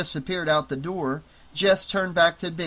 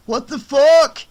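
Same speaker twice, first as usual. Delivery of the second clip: loud, slow, startled curse; shocked outburst; 10 semitones higher, 11 dB louder, slower than usual. Acted. Falling guard swears in shock, loud and slow. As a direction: blurt it out mid-fall. Eric falls down stairs.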